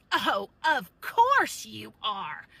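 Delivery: slow, loud, mocking sneer in a put-on voice; sarcastic mimicry. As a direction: drag it out like a snide old lady. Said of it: "And she goes".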